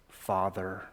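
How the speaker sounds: soft, low and tender "Father"; intimate tone. Retold or imitated. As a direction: call him Father with loving dependence. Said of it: "It's the tone of intimacy, the tone of love".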